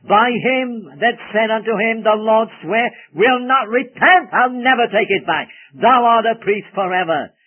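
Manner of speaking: loud, high, declaiming the oath; full-force preaching; booming scripture. Real, not acted. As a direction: proclaim it like thunder from the pulpit. Preacher booms scripture quote.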